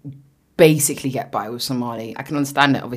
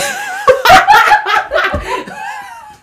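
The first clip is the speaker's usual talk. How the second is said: loud laughing fit; 7 dB louder than usual. Real, not acted. Cracking up after the accent.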